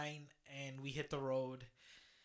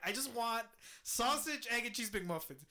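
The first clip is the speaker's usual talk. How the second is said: exasperated repeat; 6 semitones higher than usual. Retold or imitated. Repeating the order to the clueless cashier, fed-up insistence.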